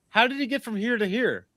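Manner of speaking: high-pitched incredulous question; acted-out puzzled onlooker; baffled. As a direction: ask it high, in mock bewilderment. Acts out baffled players' reaction.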